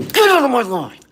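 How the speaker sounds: high, full yell. Real, not acted. Throwing a commenter out, louder.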